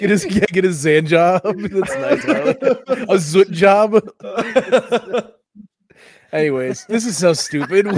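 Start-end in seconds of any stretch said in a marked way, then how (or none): 0.0-2.5 louder, higher, cracking up; laughing hard. Real, not acted. Cracking up over running Z gag. 2.5-5.4 high, loud, drawn-out laughing words; helpless laughter. Real, not acted. Cracking up over running Z gag.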